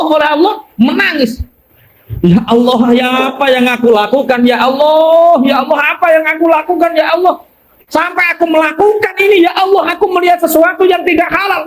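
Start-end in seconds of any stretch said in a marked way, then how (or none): 2.2-11.7 ratapan tobat. Acted. Abid meratap kepada Allah.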